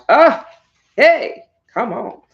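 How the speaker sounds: high, loud squeal of delight. Real, not acted. Reacting to her book on camera, a loud high squeal. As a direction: burst out with joy.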